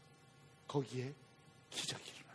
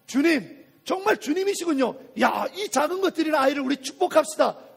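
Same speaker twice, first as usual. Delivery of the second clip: high, loud exclamations of praise; loud, impressed proclamation; acted awed acclaim; 6 semitones higher, 6 dB louder than usual. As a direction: hail the Lord like an amazed crowd. Acting out crowd praising after miracle.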